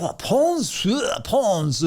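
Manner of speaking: high, puzzled repeating of the town; puzzled French locals. Retold or imitated. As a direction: locals trying to make sense of Pons.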